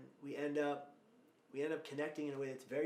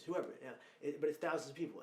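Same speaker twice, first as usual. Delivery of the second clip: ordinary delivery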